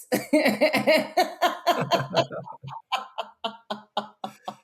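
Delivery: a long, falling burst of real laughter. Real, not acted. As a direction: laugh out at your own punchline. Laughs at own swingers anecdote.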